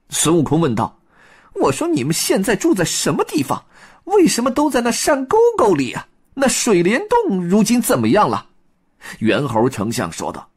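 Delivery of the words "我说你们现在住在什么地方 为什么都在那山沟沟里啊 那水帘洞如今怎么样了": high, quick Monkey King questions; high Monkey King voice, anxious questions; Monkey King asking. Acted. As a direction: ask urgently what happened to home.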